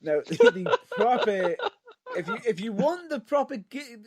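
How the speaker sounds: talking through giggles; laughing. Real, not acted. Laughing while arguing.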